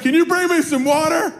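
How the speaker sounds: put-on high, pleading little-girl holler; imitated child yell. Daughter asking dad for water from bed, imitated high yell. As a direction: call down like a kid wanting something. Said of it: "hear Natty screaming from her room".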